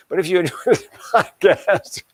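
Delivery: amused, laughing at own joke while talking. Real, not acted. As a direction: laugh through the repeated plug. Laughs after own self-deprecating joke.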